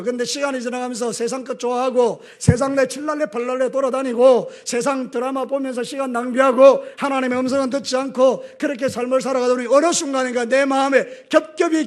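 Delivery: ordinary delivery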